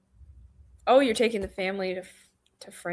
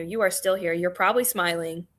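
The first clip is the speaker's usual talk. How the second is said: ordinary delivery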